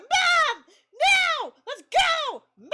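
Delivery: very high, loud imitated yells; mock cat screaming. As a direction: scream like an impatient pet calling its mother. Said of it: "he's just screaming".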